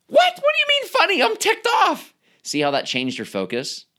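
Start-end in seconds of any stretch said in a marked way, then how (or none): 0.1-2.1 very high, mock-outraged squeak; indignant squeak. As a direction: play a huffy little voice protesting. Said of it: "imagined reply to a suggestion".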